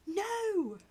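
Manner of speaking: put-on a child's desperate shout; child screaming no at his father; sudden cry.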